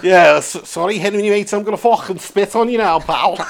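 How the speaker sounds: spitty fake Scouse; badly faked Scouse threat; mock Scouse accent. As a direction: play a threatening Liverpudlian, half laughing. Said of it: "that accent was proper abysmal".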